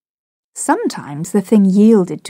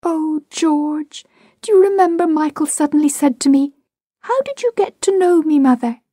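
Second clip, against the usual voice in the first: wistful mother; 8 semitones higher than usual. A narrator's reading. Mother recalling fondly.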